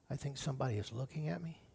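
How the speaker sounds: very soft, low, quick murmur; hushed, private inner voice; hushed half-asleep thought. Acted. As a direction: murmur it like a parent stirring from sleep. Parent half-waking, sensing someone.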